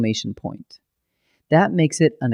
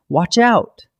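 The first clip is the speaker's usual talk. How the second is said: higher, louder urgent warning cry; shouted warning; 6 semitones higher, 5 dB louder than usual. Shown as a demonstration. Teacher demonstrating a forceful command, sharp loud warning. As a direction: shout it like a sudden alarm. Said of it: "a shouted order".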